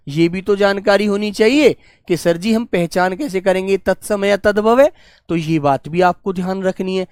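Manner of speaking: normal talking voice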